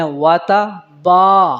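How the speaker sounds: "واتا با" slow, with با held long; lengthened vowel. Shown as a demonstration. Teacher shows it must be long, slow "واتا با". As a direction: draw out the با in "واتا با". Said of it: "اس کو بھی لمبا کرنا ہے".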